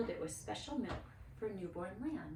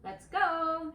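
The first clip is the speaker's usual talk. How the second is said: higher, louder eager call; 9 semitones higher, 8 dB louder than usual. A narrator's reading. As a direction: call the family to leave. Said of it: "she called".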